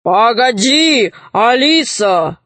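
slow, drawn-out heroic call; calling bogatyr. A narrator's reading. Bogatyr calls after fleeing Alisa, drawn-out shout. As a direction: call after her, stretching the words. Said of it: "крикнул богатырь".

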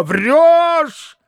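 high angry shout; shouted accusation. A narrator's reading. Mitya shouts at the frightened maid, high angry cry. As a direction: hurl the word at her. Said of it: "вскричал Митя".

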